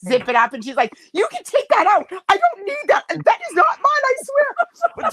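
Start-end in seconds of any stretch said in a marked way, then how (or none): 1.1-5.1 very high-pitched mock protest; hammed-up denial, voice pitched way up; frantic protesting. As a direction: imitate a flustered sister insisting on innocence. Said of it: "the more she, like, was protesting it".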